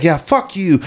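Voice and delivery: imitated trash talk. Opposing player talking shit online, imitated jeer. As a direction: lightly mimic an opponent swearing. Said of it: "He's like".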